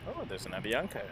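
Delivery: usual speaking voice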